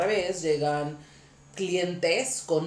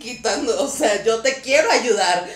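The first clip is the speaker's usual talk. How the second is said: louder, indignant mock rant; mock angry; 5 semitones higher, 9 dB louder than usual. Stylist silently scolding the submissive client, mock angry.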